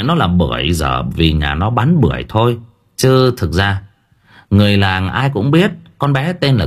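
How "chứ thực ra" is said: ordinary delivery, nothing marked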